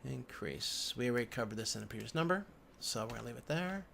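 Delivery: ordinary delivery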